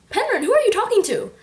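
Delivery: high, fast worried mother's call; frantic mom. Retold or imitated. Book-reading vlogger voicing a frantic mom. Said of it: "My mother sounds almost frantic now".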